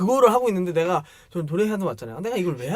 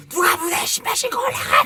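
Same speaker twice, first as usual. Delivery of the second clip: a senior screaming at a junior, much higher and louder; angry shout; 4 dB louder than usual. Retold or imitated. Senior student yells at freshmen for wearing stage shoes, angry shout. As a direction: bark it like a furious upperclassman.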